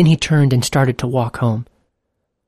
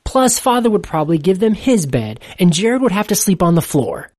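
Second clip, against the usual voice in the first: usual speaking voice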